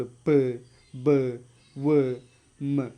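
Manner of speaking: പ്, ബ്, വ്, മ് each said alone with both lips; bilabial sounds. Shown as a demonstration. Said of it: "രണ്ട് ലിപ്പും use ചെയ്ത്". (പ്, ബ്, വ്, മ് രണ്ട് ചുണ്ടും ചേർത്ത് ഓരോന്നായി)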